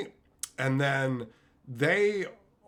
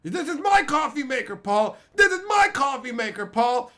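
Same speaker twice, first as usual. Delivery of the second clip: high, loud furious outburst; mock furious yell; 10 semitones higher, 7 dB louder than usual. Crackhead yelling at Paul over his coffee maker, furious and high. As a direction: scream it at Paul, pitched way up.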